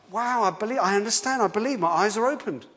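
a sudden joyful wow of realization; excited discovery. Pretend. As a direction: voice the thrill of a new believer. Convert's moment of belief voiced.

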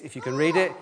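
ordinary talk

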